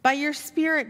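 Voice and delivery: ordinary talk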